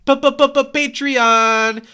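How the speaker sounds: high, loud stuttered P-P-P-Patreon; comic hype stutter of the plug; mock stutter. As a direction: hammer the P-P-P- before Patreon like a jingle.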